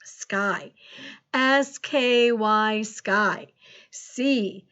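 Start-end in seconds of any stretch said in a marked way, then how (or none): 1.3-2.8 slow, letter by letter; measured, separated letters; spelling aloud. Shown as a demonstration. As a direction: spell it out slowly for a class. Teacher spelling a word aloud.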